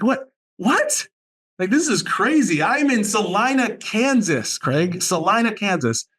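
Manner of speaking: high-pitched retold astonishment; mock disbelief, pitch leaping up; incredulous. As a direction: act baffled that anyone would come here. Retold amazement at where students come from.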